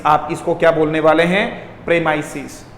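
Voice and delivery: usual speaking voice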